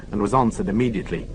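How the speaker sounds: ordinary delivery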